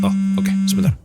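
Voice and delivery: sudden high startled burst. Acted. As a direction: jump at the interruption. Startled by interruption, phone call.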